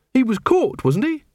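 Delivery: high-pitched tag question; puzzled recruit. Acted. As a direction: challenge him, voice pitched right up. Angua challenges Carrot's remark.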